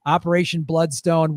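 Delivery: ordinary talk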